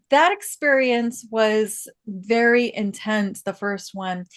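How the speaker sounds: ordinary talk, nothing marked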